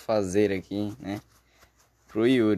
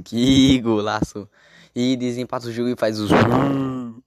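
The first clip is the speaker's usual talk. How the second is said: explosive goal commentary; 6 dB louder than usual. Acted. Goal scored, commentator shout. (narração de gol explosiva)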